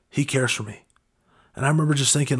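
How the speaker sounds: ordinary delivery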